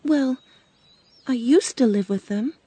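a touch of wistfulness as you go on; sadly. A narrator's reading. Dorothy tells of her lost home, sadly. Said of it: "she went on sadly".

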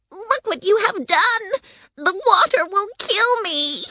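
very high-pitched wailing witch; witch's horrified cry. A narrator's reading. Witch drenched with water by Dorothy, horrified wail. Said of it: "the witch cried".

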